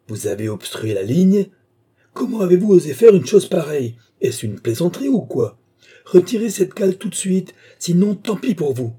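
from disbelief build to an angry order; outraged demand. A narrator's reading. Officer orders saboteur to remove the wedge, outraged.

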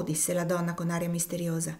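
usual speaking voice

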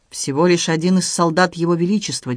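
plain reading voice

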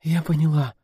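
high, meek gasped assent; breathless babble. A narrator's reading. Actress tells the director she understood, breathless babble. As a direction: squeeze it out short of breath. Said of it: "пролепетала актриса, задыхаясь".

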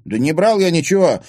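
high, loud, heated denial; shouting. A narrator's reading. Timofey denying the theft, shouting. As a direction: shout back in protest. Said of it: "крикнул Тимофей".

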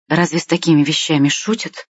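plain reading voice

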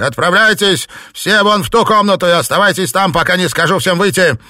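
loud, high commanding yell; angry orders. A narrator's reading. Master ordering servants away, angry shout. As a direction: drive them out of the room.

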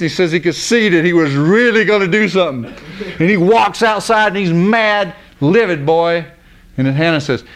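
ordinary talk, nothing marked

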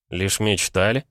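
ordinary delivery, nothing marked

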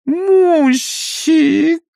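put-on high, drawn-out babyish call; playful toddler voice. Engineer cooing to his wife like a toddler. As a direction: coo it like a spoiled little boy. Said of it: "голосом шаловливого карапуза протянул".

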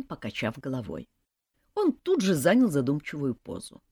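usual speaking voice

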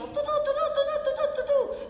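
high, loud mocking "tu, tu, tu"; mocking chatter. Worker mocking her colleague's nagging, high and loud. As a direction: parrot his endless nagging.

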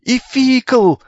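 high, piercing yell across the yard; shrill screech. Acted. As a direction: shriek for your big brother. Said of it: "пронзительно заверещал".

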